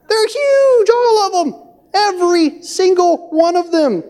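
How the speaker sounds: very high, panicky mock wail; alarmist spies. Ten spies spreading bad report among the Israelites, hammed-up alarm. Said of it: "beginning to spread this bad report".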